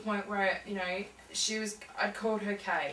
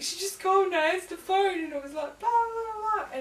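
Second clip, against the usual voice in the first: much higher, louder, half-crying report; retold sobbing; 12 semitones higher, 6 dB louder than usual. Telling her husband about the call, sobbing. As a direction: blurt it out through tears. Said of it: "bawling my eyes out".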